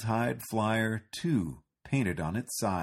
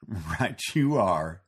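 laughing; 3 semitones lower, 4 dB louder, much slower than usual. A narrator's reading.

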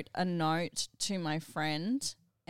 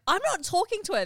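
mock angry refusal; 12 semitones higher, 6 dB louder, faster than usual. Retold refusal during sibling fight.

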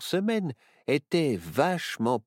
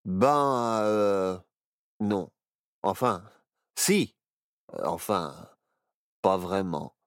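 mumbling; 4 semitones lower, slower than usual. A narrator's reading. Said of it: "marmonna Barnabé".

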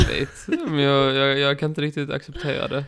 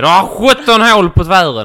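mock dentist exclamation; 5 semitones higher, 13 dB louder than usual. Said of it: "utan att den säger".

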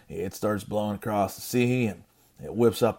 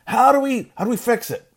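high, loud pleading questions; desperate sailors; 13 semitones higher, 7 dB louder than usual. Acted. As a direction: beg for a way out of the storm. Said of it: "So they ask him".

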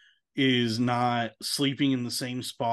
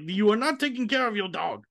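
higher, scolding German vet voice; a German accent leaning on the word not as he scolds the owners; mock scolding German accent; 8 semitones higher than usual. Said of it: "you scold these people".

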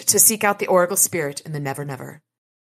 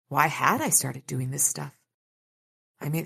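normal talking voice

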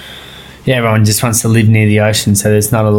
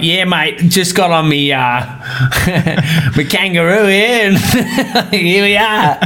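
higher, laughing, over-the-top Australian bloke; broad mock Aussie; 6 semitones higher than usual. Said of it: "they go back to us".

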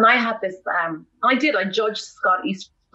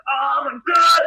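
higher, louder burst of embarrassed shock; mortified exclamation; 6 semitones higher, 5 dB louder, slower than usual. Retold or imitated. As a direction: blurt it out in horror at yourself. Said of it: "I was like".

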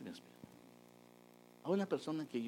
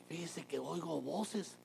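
slow, higher, hesitant admission of hearing voices; timid confession; 8 semitones higher, slower than usual. Shown as a demonstration. A churchgoer mocking a patient confessing to voices, slow and hesitant. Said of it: "Si usted llega y dice".

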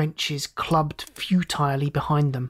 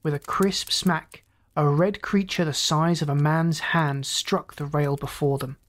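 ordinary delivery, nothing marked

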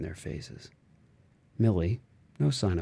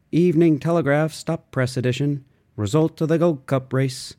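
louder, higher newsboy call; newsboy's crying call; 7 semitones higher, 8 dB louder than usual. A narrator's reading. Newsboy crying the evening paper, loud and high. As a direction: cry the headlines down the street. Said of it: "a shrill voice went crying, wailing".

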